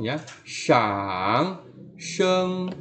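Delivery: drawn-out dip and rise; slow dipping tone; third tone. Shown as a demonstration. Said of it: "nada ketiga disebut shang sheng".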